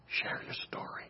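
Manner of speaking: barely breathed, intimate whisper. Acted. Preacher urging listeners to testify, soft whisper. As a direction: lean in and whisper the plea. Hushed appeal to congregation.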